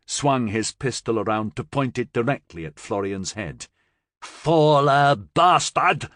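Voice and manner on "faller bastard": loud, slow, spat-out curse; slow, loud hateful snarl at the gunpoint; furious curse. Acted. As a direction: spit the insult at him. Peric aims pistol, cursing.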